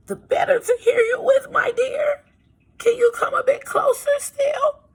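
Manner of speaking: wolf straining to sound like granny; fake granny voice.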